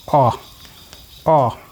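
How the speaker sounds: OH said as a very brief vowel; short vowel sound. Shown as a demonstration. Teacher comparing short and long sounds, short OH. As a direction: keep each OH short. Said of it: "compare these short and long sound".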